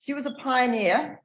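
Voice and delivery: ordinary talk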